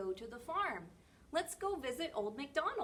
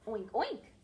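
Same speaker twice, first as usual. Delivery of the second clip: pig imitation; 6 dB louder than usual. Shown as a demonstration. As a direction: snort and oink like a pig. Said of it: "A pig says".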